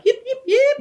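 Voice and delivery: high yipping coyote imitation; sharp high coyote yips. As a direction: yip like a coyote pack. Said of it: "They're like".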